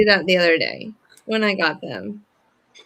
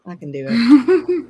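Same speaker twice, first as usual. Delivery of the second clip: laughing; 2 semitones higher, 6 dB louder, slower than usual. Real, not acted. Laughing boast.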